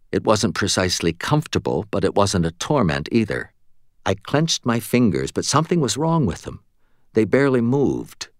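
plain reading voice

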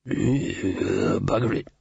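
put-on low muttering old beggar; foul old Ron. A narrator's reading. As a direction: grumble it from deep down like a mad old tramp. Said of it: "said foul old Ron".

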